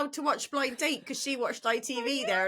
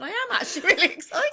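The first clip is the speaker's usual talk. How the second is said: raised, quicker, laughing insistence; genuinely excited; 7 semitones higher, 5 dB louder, faster than usual. Real, not acted. Co-host correcting her disingenuous-sounding reaction, genuinely excited. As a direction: protest real excitement through a laugh. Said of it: "it sounded very disingenuous, that".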